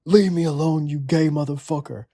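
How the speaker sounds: high-pitched imitation of an angry scream; mock screaming. Furious prank-call victim telling the caller off, mock screaming. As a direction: imitate an outraged yell, pitched well up. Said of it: "They'd be screaming".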